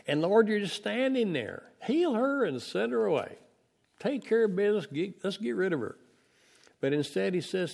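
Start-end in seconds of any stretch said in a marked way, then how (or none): 0.1-6.0 high, pushy urging; impatient disciples. Acted. Disciples pushing Jesus to send her away, high and impatient. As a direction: hurry him up to deal with her.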